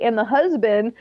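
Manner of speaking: usual speaking voice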